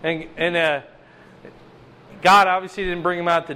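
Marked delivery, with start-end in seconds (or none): none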